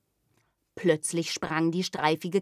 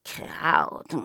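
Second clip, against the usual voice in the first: whispered, nose-wrinkling scorn; disdainful whisper. Acted. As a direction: sniff at the word. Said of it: "die Katze rümpfte die Nase".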